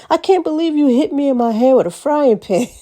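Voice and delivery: high-pitched mock outrage; retold disbelief. Retells friend's outrage at being hit.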